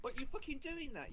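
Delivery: high, slow startled outburst; surprised. Real, not acted. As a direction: blurt it out, caught off guard. Startled during duel.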